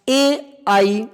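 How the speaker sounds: ordinary talk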